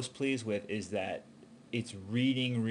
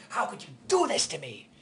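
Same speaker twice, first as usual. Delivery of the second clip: big climax line; 9 semitones higher, 3 dB louder than usual. Shown as a demonstration. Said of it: "there needs to be, like, this big".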